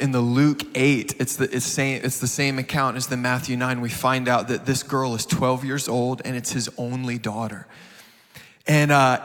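ordinary talk, nothing marked

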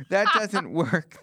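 laughing through the line, drawn out. Acted. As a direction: let the laugh break through slowly. Punchline repeated with laugh.

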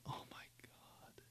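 breathless unvoiced whisper, slowed. Sick from eating the whole bag, whispering in despair. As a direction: barely breathe the words out. Overwhelmed from sour candy.

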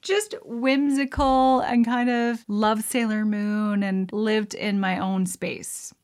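ordinary talk

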